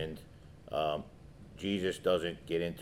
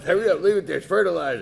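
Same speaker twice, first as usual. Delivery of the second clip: high, loud comic brush-off; mock impatience; 10 semitones higher, 7 dB louder, faster than usual. Dog owner excusing the mess left behind, mock impatient. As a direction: wave it away in a raised, hurried voice. Acts his impatient former self.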